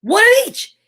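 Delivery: high, loud delighted cry; excited reveal. Real, not acted. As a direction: announce it with delight.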